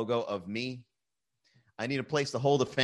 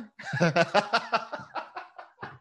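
long high cackle tailing off; laughing; 7 semitones higher than usual. Real, not acted. Host after his French pickup line, cracking up. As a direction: crack up at your own joke.

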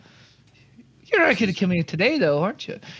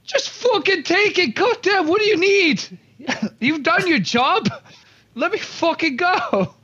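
exasperated shout; 8 semitones higher than usual. Acted. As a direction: throw it at them, fed up. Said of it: "I say".

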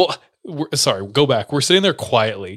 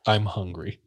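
sullen kid imitation; 4 semitones lower, 6 dB quieter, slower than usual. Imitates sullen kid's short answer.

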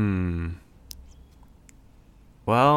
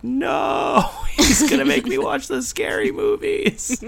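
put-on much higher, loud childish whine; scared kid protest; 3 dB louder than usual. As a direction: whine like a frightened little kid. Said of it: "'cause I'd be like".

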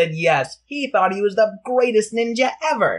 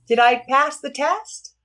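put-on high kid voice; 8 semitones higher than usual. Teddy asks hidden teacher.

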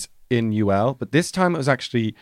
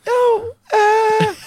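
hammed-up high, nervous falsetto; awkward squeak; 6 dB louder than usual. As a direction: stall in a loud, squeaky mock panic. Awkward reply to pupil's 'Africa'.

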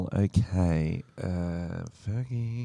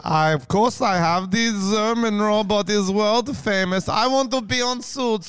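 German robot voice; 9 semitones higher than usual. Acted. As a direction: chant like a clunky German robot. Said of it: "the world famous Tom and Frenchie German robot impression".

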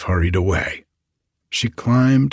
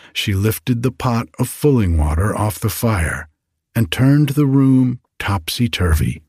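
ordinary delivery

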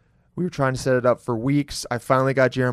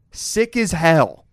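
mock smart speak; 3 semitones higher, 4 dB louder, much slower than usual. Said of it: "as I like to say in my smart speak".

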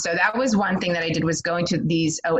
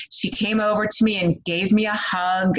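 usual speaking voice

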